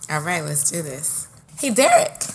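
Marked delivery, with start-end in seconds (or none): 1.6-2.2 mock ad host. Mock commercial opening, host voice.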